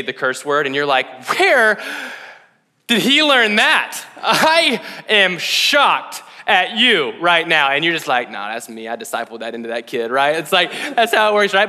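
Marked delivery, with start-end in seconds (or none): 1.4-7.7 loud, high, drawn-out outrage; mock parental shock. Parent reacting to a kid's curse word, loudly appalled. 8.3-10.1 lower, much softer aside; sheepish parental admission. Acted. Parent owning up to the kid's habit, sheepish and hushed. Acted parent quietly admits the blame.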